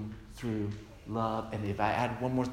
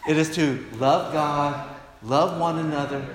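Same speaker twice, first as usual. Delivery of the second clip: congregation recitation; 2 semitones higher, 7 dB louder than usual. Real, not acted. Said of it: "Say it with me".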